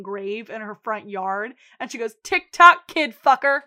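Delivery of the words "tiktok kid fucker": loud, punched-out taunting threat; retold taunt. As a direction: throw it out loudly as a parting threat.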